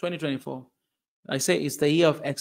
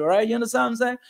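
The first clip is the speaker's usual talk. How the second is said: ordinary delivery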